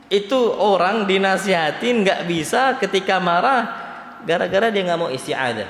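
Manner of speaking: ordinary talk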